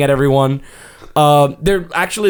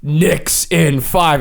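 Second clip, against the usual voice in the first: slow, spaced-out reading; measured, half-speed slogan; big words; much slower than usual. Retold or imitated. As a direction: read it out like a big on-screen slogan. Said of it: "in big words, it goes".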